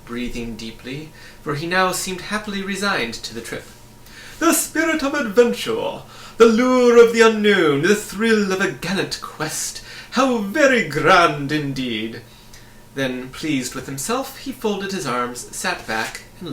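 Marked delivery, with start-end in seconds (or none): 4.4-12.2 high, loud, slowing to a grand finish; grand pompous humbug. A narrator's reading. Humbug proclaims the thrill of adventure, loud and pompous. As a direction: orate like a self-important bug.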